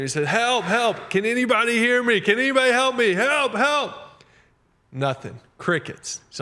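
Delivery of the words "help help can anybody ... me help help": high, loud cries for help, rising higher each time; desperate retold calls for help, getting higher; panicked screaming for help. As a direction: yell for rescue while hanging off a cliff. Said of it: "he starts screaming".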